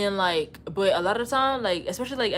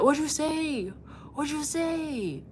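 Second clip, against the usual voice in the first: high, drawn-out mock challenge; playful calling out; 7 semitones higher, much slower than usual. Streamer calling out someone in the chat, high teasing challenge. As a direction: pitch it up and stretch it out teasingly. Calling out a supposedly ducking commenter.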